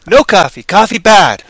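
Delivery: loud, blunt caveman grunt; no coffee, coffee bad, in a loud primitive voice; caveman refusal. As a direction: bark it like a caveman who hates coffee.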